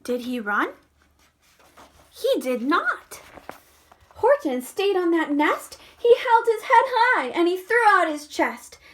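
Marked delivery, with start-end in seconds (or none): none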